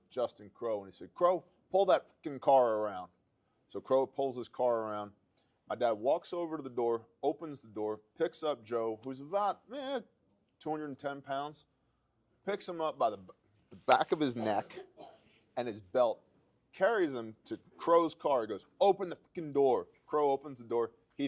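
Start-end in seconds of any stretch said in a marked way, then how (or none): 1.2-3.1 louder, barked order from an enraged father; retold angry command. Dad orders Crow to bring the car, loud angry command. 18.8-19.9 loud, higher furious order; retold angry command. Dad tells Crow to open the car door, loud angry order.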